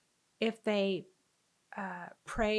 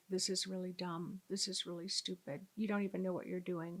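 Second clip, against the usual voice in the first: quiet retold self-talk; inner self-doubt; 8 dB quieter, faster than usual. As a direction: say it under your voice, as if scolding yourself privately. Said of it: "in my mind, I was like".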